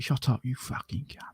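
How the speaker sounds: quiet, menacing whispered threat; angry. Shown as a demonstration. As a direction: whisper the insult with real bite behind it. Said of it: "there's anger behind it".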